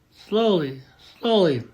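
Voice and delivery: ordinary delivery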